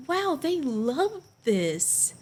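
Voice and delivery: slow, high retold amazement; amazed wonder. Performer realizing the kids love it, slow amazement. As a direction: draw it out in wonder.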